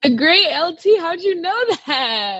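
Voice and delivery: high, loud, astonished questions; delighted surprise. Real, not acted. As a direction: react amazed that the interviewer knows. Reacting to interviewer naming her trainer.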